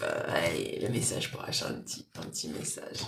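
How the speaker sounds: muttered aside. Real, not acted. Muttered aside before channeling message.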